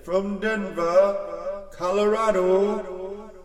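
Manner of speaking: slow, stretched, high booming announcer; boxing ring announcer. Acted. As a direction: draw out every name to the crowd. Announcer introduces the champion.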